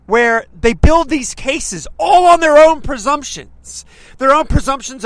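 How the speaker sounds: loud, slow, outraged rant; indignant shout. Real, not acted. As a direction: hammer each word out in disbelief. Ranting against NPR coverage.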